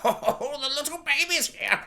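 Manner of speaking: lightly voiced doddering king; frail old king. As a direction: coo it like a feeble old king.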